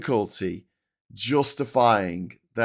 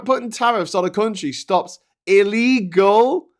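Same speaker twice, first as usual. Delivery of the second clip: illegal hit hard at the end of a raised, loud line; stress on illegal; 8 semitones higher, 5 dB louder than usual. Shown as a demonstration. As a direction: punch the word illegal. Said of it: "the emphasis on the word illegal".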